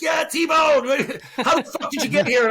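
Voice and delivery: high, loud calling out; rowdy shout through laughter; imitated rowdy cheer. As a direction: act out buddies hollering at a friend. Buddies calling out to him.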